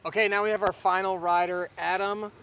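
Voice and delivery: loud, upbeat host intro; presenter voice. Real, not acted. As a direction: announce the rider like a host. Presenter introduces new rider.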